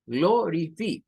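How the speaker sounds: "Glorify" read as glo-ri-fi with Spanish vowels; Spanish-style "Glorify". Shown as a demonstration. Teacher showing the Spanish misreading of "Glorify". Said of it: "Así se nos acostumbró al español".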